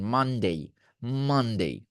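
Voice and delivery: মানডে said through the nose; nasal Monday. Shown as a demonstration. Teacher modelling nasal মানডে for learners. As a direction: hum the ন in মানডে. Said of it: "নাকটা ধরে রাখবেন আর বলবেন".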